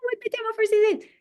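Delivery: put-on high, fast eager child blurting it out; a small boy bursting to answer; excited child. Excited boy shouting out the answer to his teacher. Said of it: "so excited".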